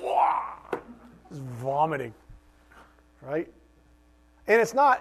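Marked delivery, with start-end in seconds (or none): 0.0-0.4 put-on high, babyish whining wah; hammed-up crybaby wah; mock whiny wail. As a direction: wail like a sulking child. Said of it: "I'm like".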